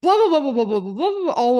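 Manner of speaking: rapid, high, loud gushing nonsense; excited babbling. Retold or imitated. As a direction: spill it all out breathlessly excited. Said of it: "I don't even know what I said".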